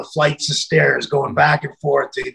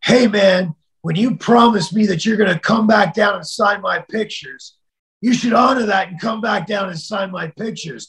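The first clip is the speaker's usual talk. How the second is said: angry caller; 6 semitones higher than usual. Retold or imitated. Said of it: "the phone goes".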